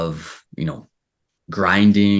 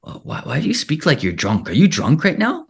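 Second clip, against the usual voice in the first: higher-pitched imitation of online trolls; mock-incredulous heckling, pitched up; mock troll questioning; 8 semitones higher than usual. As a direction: put on a puzzled troll's voice, rising high. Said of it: "they start saying things like".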